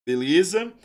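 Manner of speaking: ordinary talk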